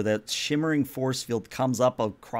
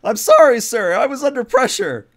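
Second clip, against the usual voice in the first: high, loud flustered plea; flustered underling's protest; panicked apology; 11 semitones higher, 9 dB louder than usual. Acted. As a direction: blurt an apology to an angry superior. Plays Jensen apologizing when yelled at.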